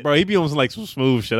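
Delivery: normal talking voice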